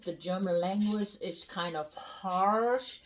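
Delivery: normal talking voice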